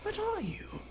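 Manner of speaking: high, startled woman's voice; startled shopkeeper woman. Acted. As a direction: gasp it out as a spooked shop girl. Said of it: "this girl's kinda thrown off guard as well, like".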